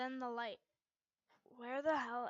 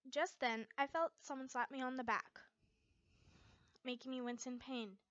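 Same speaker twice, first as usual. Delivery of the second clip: ordinary delivery, nothing marked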